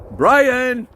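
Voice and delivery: loud shout across the field; calling the dog. Real, not acted. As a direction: yell the dog's name to call him back. Calling his dog on walk.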